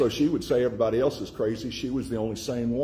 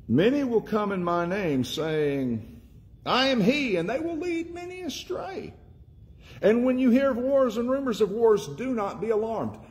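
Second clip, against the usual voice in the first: higher, climbing to a shouted I am he; impassioned warning; 10 semitones higher than usual. Acted. Preacher crying out Jesus' warning to the congregation, high shout.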